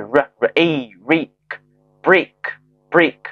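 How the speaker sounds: slow r, long A, building to "break"; sound-by-sound blending. Shown as a demonstration. Teacher sounding out a rare A word, slow blending. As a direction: draw out A, then join up break. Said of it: "前面的辅音是r".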